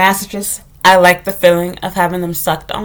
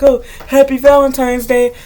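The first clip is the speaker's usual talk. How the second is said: mocking boyfriend; 3 semitones higher than usual. Mocking her boyfriend handing over a lame gift, dopey voice. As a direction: put on his clueless gift-giving voice. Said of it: "And be like".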